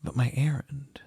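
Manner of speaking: mildly wavering objection. A narrator's reading. Nun torn between duty and love, gently wavering. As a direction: protest with a touch of hesitation. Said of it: "she wavered".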